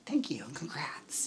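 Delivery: soft unvoiced whisper. Real, not acted. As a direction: breathe the thanks in a whisper. Whispered sign-off to a viewer.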